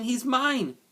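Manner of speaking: put-on high, loud girl's scream; screamed demand. A narrator's reading. Girl demanding her rabbit back from the boys, screamed. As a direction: scream it, desperate to get it back. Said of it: "screamed Abilene".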